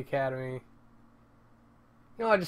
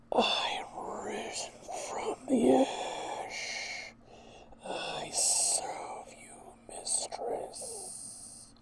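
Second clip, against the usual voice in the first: slow, higher whispered rasp; raspy villain whisper; 11 semitones higher, 5 dB quieter, much slower than usual. Shown as a demonstration. Voicing his game character Jack, slow rasping whisper. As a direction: breathe it out like a risen ghoul. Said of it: "Something like that".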